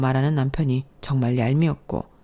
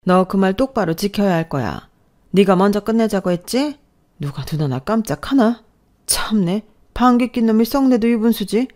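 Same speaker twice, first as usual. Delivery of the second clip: threatening, huffy elder; indignant mother-in-law; 4 semitones higher, 4 dB louder than usual. Acted. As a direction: throw the blame back at her, scoffing. (기가 막힌다는 듯 쏘아붙이는 시어머니)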